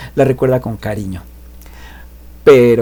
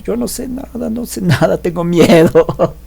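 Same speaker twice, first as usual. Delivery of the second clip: put-on scared boy; 7 semitones higher than usual. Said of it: "el prota así con los ojos cerrados".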